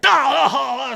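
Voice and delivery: high, strained yelps of burned hands; imitating an old man's scalded cries; mock pained yelps. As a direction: yelp like scalded fingers. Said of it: "he's like".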